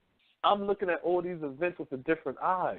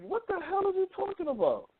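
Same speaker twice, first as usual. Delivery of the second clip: much higher, mock outrage; incredulous; 14 semitones higher than usual. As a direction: act stunned by what mom said. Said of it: "And I'm like".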